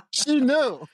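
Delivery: cracking up, voice shooting way up high; laughing. Real, not acted. Retelling wife's reaction to the noisy match, laughing. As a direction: squeal it through helpless laughter.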